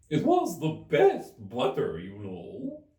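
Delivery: slow, loud hare insisting; March Hare voice. Acted. March Hare repeating his excuse to the Hatter, slow and loud. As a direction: lean on it slowly and loud.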